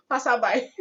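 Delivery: high bursts of laughter; real high laugh. Real, not acted. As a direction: crack up mid-word. Laughs at Dupe's story.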